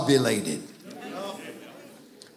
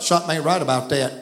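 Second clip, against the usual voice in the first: Southern saying; 4 semitones lower, much faster than usual. Shown as a demonstration. To the congregation, showing how Southerners say it, in a quick Southern way. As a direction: toss it off like a Southern local. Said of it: "We would say it like this in Southern".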